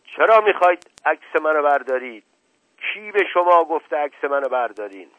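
furious demand at the photographer; angry shout. Acted. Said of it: "با لحن عصبانی فریاد زد".

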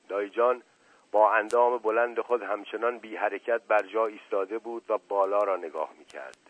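ordinary delivery, nothing marked

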